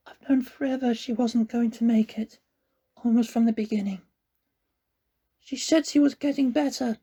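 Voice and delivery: put-on high, halting boy confessing; a boy admitting the truth; grieving boy. A narrator's reading. Connor confesses.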